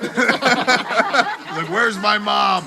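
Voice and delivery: slow, high words broken by laughter; laughing through it. Real, not acted. Retelling his hospital walk to friends, laughing through the line. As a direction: laugh while trying to say it.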